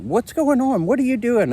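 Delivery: high-pitched mock wife voice; mocking his wife's panic, way up high; imitating wife. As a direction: squeak out the wife's alarm. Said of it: "She's like".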